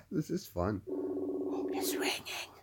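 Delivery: soft, secretive whisper; barely voiced, keeping quiet; hushed whisper. Real, not acted. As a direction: whisper it while the line rings. Hushed while prank call rings.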